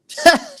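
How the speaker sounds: short loud laugh of surprise; sudden laugh at John's comment; burst of laughter. Real, not acted. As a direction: let out a quick, loud laugh.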